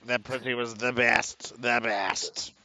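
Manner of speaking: slow boastful mock Trump; Trump impression. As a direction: brag it like Trump. Mocking Trump voice.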